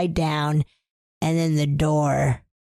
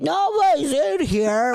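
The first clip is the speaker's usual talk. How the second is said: dismissive dad; 8 semitones higher than usual. Retold or imitated. Retelling dad's dismissal.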